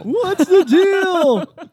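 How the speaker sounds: high, loud, very drawn-out comic parody; Jerry Seinfeld. Acted. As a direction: squeal out a hammed-up observational comedian. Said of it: "I could do a Seinfeld".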